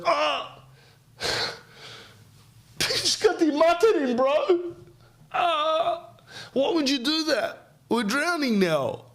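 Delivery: very high, pained squeal; stung frog. Acted. Stung frog crying out at the scorpion, very high.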